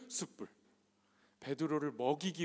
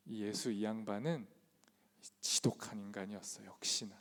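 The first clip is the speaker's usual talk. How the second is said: sulky inner grumble; 4 semitones lower, 5 dB quieter than usual. Pretend. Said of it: "저라면 이렇게 들렸을 겁니다".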